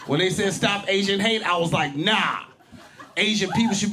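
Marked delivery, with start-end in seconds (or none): none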